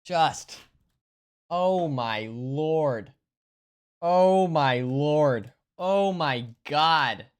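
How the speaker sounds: drawn-out, very slow moans of delight; savoring. Real, not acted. Tasting coffee ice cream on stream, savoring. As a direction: stretch each word, relishing the taste.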